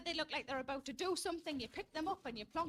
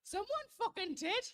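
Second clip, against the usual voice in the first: bitter punchline; 6 semitones higher, 4 dB louder, slower than usual. Real, not acted. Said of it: "punchline retort".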